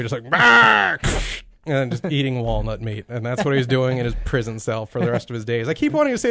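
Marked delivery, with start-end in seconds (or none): 0.3-1.0 loud, high gruff sea captain bark; old sea captain. Retold or imitated. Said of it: "talking like an old sea captain".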